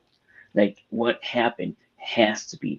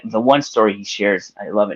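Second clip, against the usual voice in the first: ordinary talk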